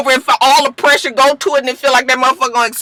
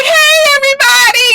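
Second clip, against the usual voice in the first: very high singsong mock greeting; bubbly greeting; 4 dB louder than usual. New girl walking in to the table, bubbly imitation. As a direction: squeal hello like a gushing newcomer.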